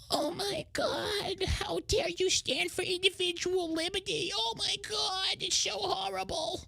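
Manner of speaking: very high, slow, soft pearl-clutching whine; mock whining. Mocking outraged critics with a high, slow, soft whine. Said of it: "I'm gonna stop the whining".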